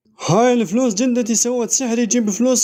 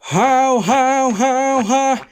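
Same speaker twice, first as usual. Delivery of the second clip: slow drawn-out howling cries; crazy howling; 3 semitones higher, much slower than usual. Acted. Father drawn-out howling after winning money. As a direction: howl it out slowly like a man gone mad.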